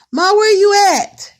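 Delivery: loud high call across the house; calling out to mom. Retold or imitated. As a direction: shout for mom from another room. Calling out to her mother.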